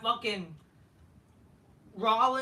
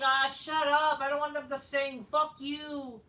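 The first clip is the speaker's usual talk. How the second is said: higher-pitched mock annoyance; mock irritated outburst; 5 semitones higher, 3 dB louder than usual. Wrestler not wanting them to sing, hammed-up irritation. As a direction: start loud, ease off into petulant complaint. Acted irritation at a singing crowd.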